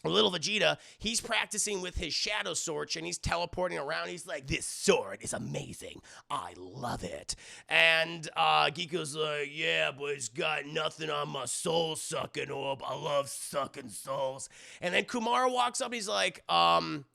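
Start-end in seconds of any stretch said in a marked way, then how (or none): 4.5-7.3 very low, slow villain voice; gloating villain. Retold or imitated. Voicing villain gloating over his sword. 9.5-14.5 low, slow brute voice; soul-eating brute. Retold or imitated. Voicing soul-eating brute in retelling.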